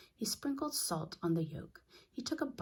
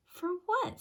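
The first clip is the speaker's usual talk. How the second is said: surprised; 4 dB louder than usual. A narrator's reading. Father asks in surprise.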